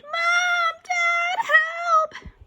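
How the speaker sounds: very high, loud cries for help; screaming. A narrator's reading. Boy calls parents to save him, screaming. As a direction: scream for rescue. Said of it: "I screamed".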